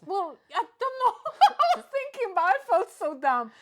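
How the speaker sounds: loud, high, laughing through the words. Real, not acted. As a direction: laugh while confessing. Laughing at own mistake.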